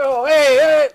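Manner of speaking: high, loud playful calls; imitating excited toddler. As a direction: cheer like a little boy suddenly fine. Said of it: "and you're".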